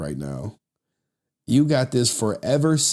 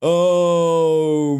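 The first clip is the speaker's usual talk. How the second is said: ordinary talk, nothing marked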